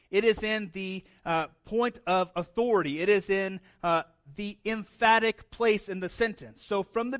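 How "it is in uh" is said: ordinary talk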